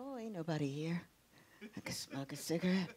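hushed, low murmur to oneself; acting out a furtive secret thought; very soft and low, as if nobody should hear; sneaky self-talk. Retells sneaking a secret cigarette.

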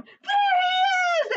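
very high, drawn-out yell; crying out. A narrator's reading. Bullies spotting Jack at the bottom, crying out. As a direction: shriek it out long and loud. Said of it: "they cried".

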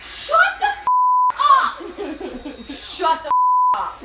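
shrieking disbelief. Real, not acted. Shocked pregnancy reveal reaction.

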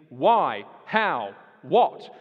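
normal talking voice

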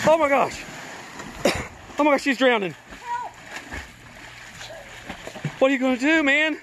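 high pretend distress cry; mock panic. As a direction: fake drowning to test the dog. Faking drowning as a test.

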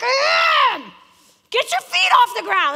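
high, loud shouted retort; exasperated protest. Retold or imitated. Player shouts back at the coach, high and loud. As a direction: yell back, fed up. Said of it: "I'm like".

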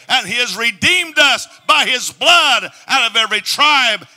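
shouted, rising sermon climax; full-force preaching. Acted. Preacher rousing the congregation, shouting in praise. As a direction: preach it out at full power, loud and high.

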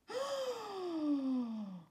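long, high, dramatic gasp; shocked gasp. Acted. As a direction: gasp in exaggerated horror. Storyteller shocked at the dropped cigar.

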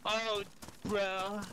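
drawn-out, unconvincing surfer bit; surfer dude attempt. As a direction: stretch it like a would-be surfer. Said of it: "that just sounded not like a surfer dude".

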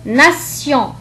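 "ناسيون" with s, then a nasal on. Shown as a demonstration. Teacher showing T before io, s in "ناسيون". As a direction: say "ناسيون" as na-syon, no t sound. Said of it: "فنطقت كحرف السين".

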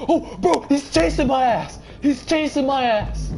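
high, loud panicked yell; real panic, pitch shooting way up; panicked shouting. Real, not acted. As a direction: scream it while being chased. Being chased in horror game.

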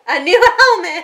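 loud, laughing cheer; excited laughing echo. Real, not acted. Mom echoing her son's reveal, loud and laughing.

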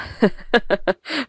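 soft breathy giggle; giggle, flattered and shy; a little embarrassed laugh. Acted. Nagham laughs shyly at compliment.

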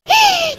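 outraged gasp. Acted. Mother-in-law feigns outrage.

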